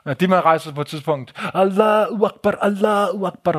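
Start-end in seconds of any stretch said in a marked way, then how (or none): 1.5-3.6 retold shouting. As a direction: imitate a man crying out, without full volume. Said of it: "råber og skriger".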